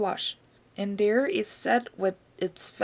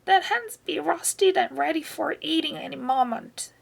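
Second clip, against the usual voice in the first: put-on higher, scolding old woman's shout; grandma shouting; 7 semitones higher than usual. A narrator's reading. Grandma on the roof scolding the boy, high shout. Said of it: "Grandma shouted".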